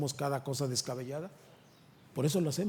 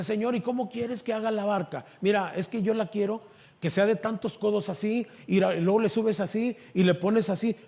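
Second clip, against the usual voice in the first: raised, eager back and forth, somewhat louder; Noah and God; 6 semitones higher, 6 dB louder than usual. Retold or imitated. Noah asking the Lord about the ark, lively and raised. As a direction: act out the chat lightly.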